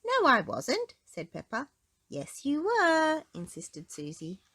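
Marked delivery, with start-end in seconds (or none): 0.0-0.9 put-on little pig girl, high and protesting; squeaky child denying it; high Peppa voice. A narrator's reading. Peppa contradicts Susie in a high piggy voice. 2.1-3.2 high, slow, insisting; little girl digging in her heels; insistent child voice. A narrator's reading. Susie insists to Peppa, high and slow.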